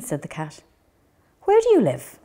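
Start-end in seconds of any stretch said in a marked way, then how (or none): 1.4-2.2 high, loud call of a cat; cat calls out to the stray dog, high and loud. Acted. Cat voice asking.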